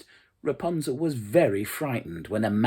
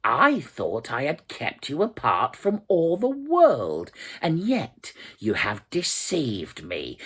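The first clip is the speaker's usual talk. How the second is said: louder, higher witch scolding; a witch scolding her captive, raised voice; betrayed witch, raised and higher; angry witch; 4 semitones higher, 6 dB louder than usual. A narrator's reading. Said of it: "cried the witch".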